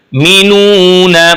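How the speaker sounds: मीनूना slowly, with a sharp catch before it; with a jolt. Shown as a demonstration. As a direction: jolt into मीनूना, then draw it out. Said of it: "झटका देकर पढ़ेंगे".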